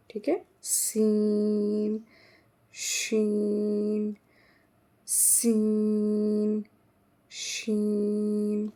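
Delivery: सीन with thin s, शीन with broad sh, slowly; s versus sh. Shown as a demonstration. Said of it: "ज़ोर से थोड़ा बोलेंगे".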